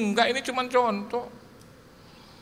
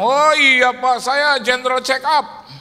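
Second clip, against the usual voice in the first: higher, louder, slow and boastful; friend's proud reply; 7 semitones higher, 8 dB louder, slower than usual. Retold or imitated. Rich friend proudly tells pastor about his checkup, loud and slow. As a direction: show off the checkup. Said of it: "Dan dia bilang".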